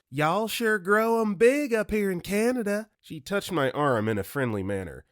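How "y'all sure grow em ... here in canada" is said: put-on flirt in a sweet Southern Belle accent. [name] flirting sweetly in Southern Belle accent with tall guard. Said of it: "in her sweet Southern Belle voice".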